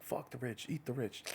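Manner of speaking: high, soft mock chanting; mock protest slogan. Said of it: "we're kinda always just like".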